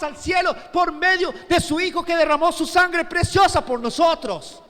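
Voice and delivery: high, impassioned sermon voice; fervent preaching. Acted. Preacher voicing Pablo marvelling at salvation, fervent wonder.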